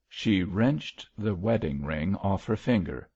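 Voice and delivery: usual speaking voice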